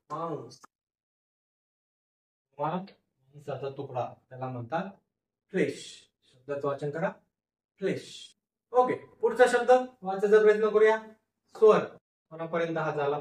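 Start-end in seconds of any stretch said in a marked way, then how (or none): none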